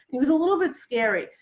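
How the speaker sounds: normal talking voice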